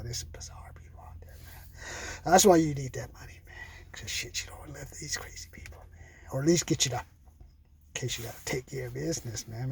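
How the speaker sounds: real whisper, confiding. Real, not acted. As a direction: drop to a whisper, as if sharing a secret. Confiding about crazy people.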